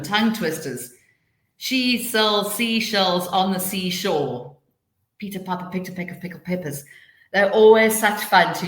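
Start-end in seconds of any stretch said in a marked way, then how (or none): 1.6-4.5 slow, deliberate tongue twister; tongue twister demo. Shown as a demonstration. Teacher showing tongue twisters for children, slow recital. 5.2-6.8 fast, softer recital; rapid tongue twister. Shown as a demonstration. Teacher showing tongue twisters for children, rapid recital.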